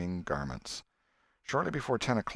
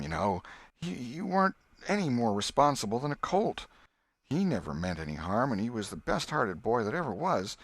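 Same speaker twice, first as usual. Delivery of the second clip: put-on higher, tearful old aunt; 4 semitones higher than usual. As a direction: plead through rising tears. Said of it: "And she began to cry".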